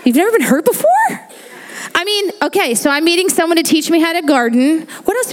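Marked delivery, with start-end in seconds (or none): 0.1-0.9 laughing disbelief. Real, not acted. Joking with congregation.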